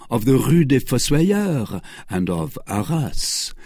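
usual speaking voice

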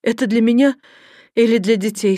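ordinary delivery

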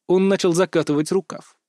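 plain reading voice

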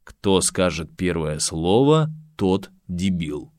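low, slow, menacing read; ominous voice. Acted. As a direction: read it like a dark omen. Reading ominous board text.

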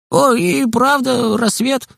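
put-on high, far boyish voice, easygoing; Kostik's voice. A narrator's reading. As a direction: lift into a carefree kid friend.

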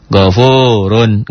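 Ghafurun with a heavy, full R, drawn out slowly; slow Ghafurun with the R pronounced full and heavy; thick ro. Shown as a demonstration. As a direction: draw out Ghafurun, the R thick and dark.

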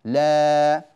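"لا" held long and loud on the alif; long drawn la. Shown as a demonstration. Teacher showing students the long alif sound in "لا". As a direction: stretch "لا" out slowly. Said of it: "هذا الصوت الآن هو صوت الألف".

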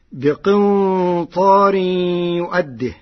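slow chanted verse; Quranic recitation. Shown as a demonstration. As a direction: chant بقنطار يؤده slowly. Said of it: "Quran recitation". (ترتيل بطيء)

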